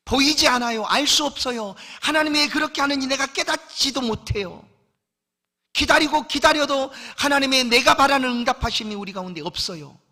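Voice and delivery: loud, high impassioned preaching; raising the voice to press the point home; full-force preaching. Acted. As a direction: preach it out with fire.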